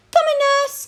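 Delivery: high, loud answering shout; distant call. A narrator's reading. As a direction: call back from down the corridor. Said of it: "came a voice from far off".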